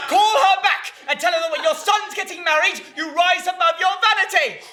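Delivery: high-pitched, forceful scolding; angry order. Acted. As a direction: lay down the law furiously.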